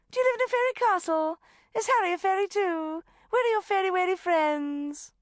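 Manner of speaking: put-on very high, loud baby talk; gushing trill. A narrator's reading. Rebecca trying to take the child's hand, trilling. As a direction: squeal questions at a little fairy.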